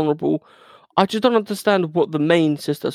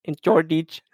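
mock Filipino accent. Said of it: "I'm from the Philippines".

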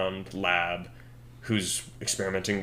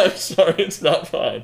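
loud, high-pitched apology through laughter; amused apology; 8 semitones higher, 9 dB louder than usual. Real, not acted. Cohost takes back approving the name Craig, amused apology. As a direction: apologise loudly while cracking up.

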